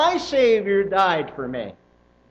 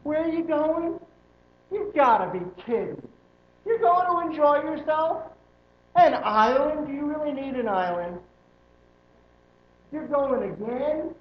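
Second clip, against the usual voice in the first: higher, mocking disbelief at vacations; incredulous eye-roll voice, pitched up; eye-rolling pastor; 7 semitones higher than usual. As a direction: scoff like a judgy pastor. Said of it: "roll his eyes and say".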